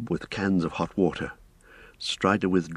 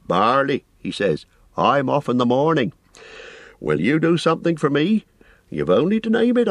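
lightly higher voice of a chatty innkeeper; a busy, apologetic innkeeper rambling on; fussy landlord; 8 semitones higher than usual. A narrator's reading.

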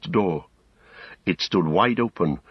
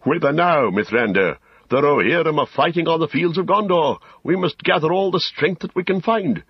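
raised, urgent call of a prince; 10 semitones higher, 3 dB louder than usual. A narrator's reading. Prince Imrahil hails Gandalf and the riders, calling out. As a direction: call out high and rousing. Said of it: "he called to them".

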